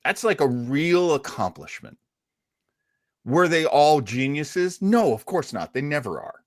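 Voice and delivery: ordinary delivery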